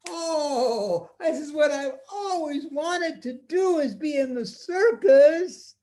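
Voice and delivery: put-on a child thrilled by his own idea; excited little boy. Boy imagining he joined the circus, high delighted voice.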